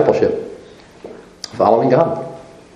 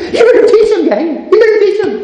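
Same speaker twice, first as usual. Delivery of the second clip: laughing urge; 12 semitones higher than usual. Real, not acted. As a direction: push them, laughing. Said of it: "laughing exhortation".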